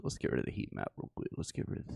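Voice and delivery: fast, whispered aside; quick whisper. Real, not acted. Removing the heat map, fast whisper. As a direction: rush the words under breath.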